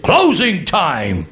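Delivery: slow drawn-out sergeant call, lightly voiced; Marine sergeant. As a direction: call closing like a gruff sergeant. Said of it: "he sounded like a Marine sergeant".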